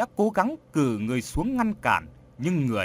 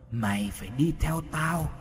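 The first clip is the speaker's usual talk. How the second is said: echoing distant voice. Acted. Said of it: "mồm bật ra âm thanh vang vọng lắm".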